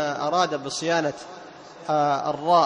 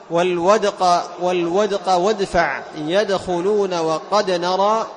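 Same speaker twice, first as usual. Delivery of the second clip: slow, louder chanted words; Quran recitation; 5 dB louder, much slower than usual. Shown as a demonstration. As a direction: recite each word with a clear qalqala. Recites word examples. (تلاوة بقلقلة واضحة)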